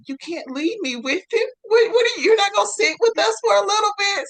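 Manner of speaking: high, loud, half-sobbing panic; panicked plea. Retold or imitated. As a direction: beg them not to go, voice cracking. Panic as worker leaves.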